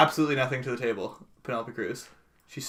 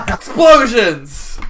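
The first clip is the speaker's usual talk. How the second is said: excited; 9 semitones higher, 13 dB louder, much slower than usual. Real, not acted. Gleeful summary of action movie.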